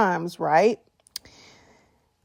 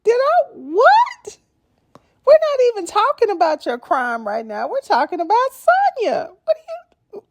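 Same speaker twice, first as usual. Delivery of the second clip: confused Tasha; 12 semitones higher than usual. Retold or imitated. Mimicking confused Tasha K questioning the guest, high baffled voice. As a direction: play it lost and mixed up. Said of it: "She's got her hand up like".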